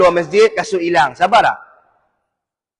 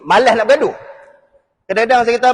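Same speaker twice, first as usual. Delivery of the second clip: ordinary talk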